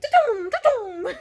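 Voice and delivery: high, loud, slow mock laser blasts; hammed-up sci-fi zaps; laser sound effect. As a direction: make cheesy CGI laser noises. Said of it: "lasers shoot out at him like".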